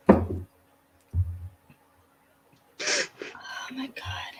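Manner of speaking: hushed, breathy oh my God; whispered. Real, not acted. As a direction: whisper it under your breath. Reacts to a co-host.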